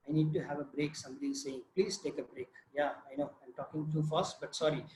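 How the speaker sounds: real low, soft interruption to oneself; quiet aside. Real, not acted. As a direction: drop the voice for an apologetic aside. Aside about being told to pause.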